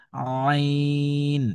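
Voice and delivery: আইন drawn out long, squeezed from mid-throat; Arabic ain from the throat; Arabic letter ain. Shown as a demonstration. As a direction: press আইন from the middle of the throat and hold it. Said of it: "এই হরফটি কিভাবে পড়ব দেখুন".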